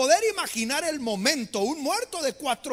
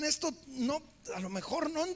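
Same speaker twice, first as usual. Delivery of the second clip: much softer, hesitant; puzzled murmur; 3 semitones higher, 8 dB quieter than usual. Retold or imitated. Disciples confused by Jesus's words, murmuring softly. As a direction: mumble in confusion.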